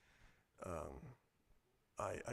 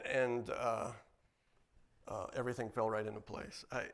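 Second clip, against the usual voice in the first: normal talking voice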